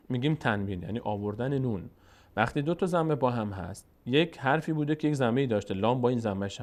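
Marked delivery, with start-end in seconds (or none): none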